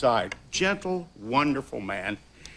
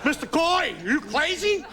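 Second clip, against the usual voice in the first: much higher, laughing, hammed-up Chinese accent; retelling how the doctor scoffs at going back to work, high mock accent; Chinese takeout cook impression. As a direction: play the stunned doctor in an exaggerated high accent, cracking up. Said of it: "began to talk like, you know, the, the cook in a Chinese takeout restaurant".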